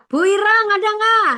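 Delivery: high, loud roll-call; memanggil absen. Real, not acted. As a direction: call the name out loudly across the group. Calling the roll. (memanggil nama, lantang bernada tinggi)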